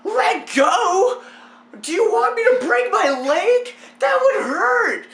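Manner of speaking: high, loud incredulous mock shriek; hammed-up panic. Hanging, answering neighbor's suggestion, panicked disbelief. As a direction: shout back in exaggerated alarm.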